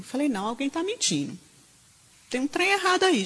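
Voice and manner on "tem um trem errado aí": high, loud cry of protest; retold outcry. Preacher retelling her protest to Jesus, loud and high. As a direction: cry out that something is wrong.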